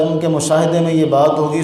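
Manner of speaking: usual speaking voice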